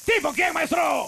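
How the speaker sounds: shout. Acted. Mock shouted question.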